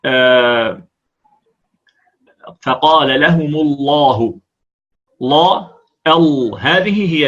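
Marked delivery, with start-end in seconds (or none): 2.6-4.4 فقال لهم الله slowly with a thick lam; heavy lam. Shown as a demonstration. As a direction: darken the l in الله. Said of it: "ونقول".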